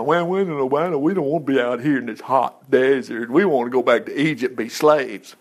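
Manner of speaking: low, grumbling complaint of the Israelites in the desert; imitating the grumbling Israelites wanting Egypt; mock whining. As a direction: whine it like a weary, sulking crowd. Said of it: "they were whining".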